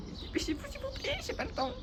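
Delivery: squeaky impatient plea; high put-on hurry-up whine; high pleading voice. Impatient, waiting for a bus.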